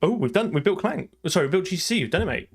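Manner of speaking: high, thrilled announcement of success; excited. Real, not acted. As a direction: celebrate the finished build, voice up high. Compiler build finally succeeds.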